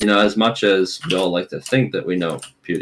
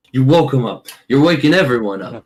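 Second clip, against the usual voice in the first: louder, higher mock scolding; playful scolding; 5 semitones higher, 4 dB louder than usual. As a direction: scold them loudly for the noise.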